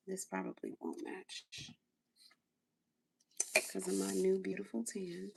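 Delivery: ordinary delivery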